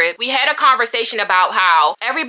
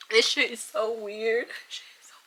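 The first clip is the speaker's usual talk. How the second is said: fading to a whisper; 11 dB quieter than usual. Real, not acted. Upset vlogger losing her voice, barely whispering. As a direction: let the words die away under tears. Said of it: "Let me calm down".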